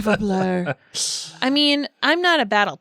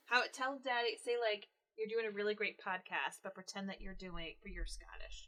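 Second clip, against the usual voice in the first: very soft, fading to a whisper; hushed prompt; 19 dB quieter than usual. Real, not acted. Mother whispers a new line for her son to say.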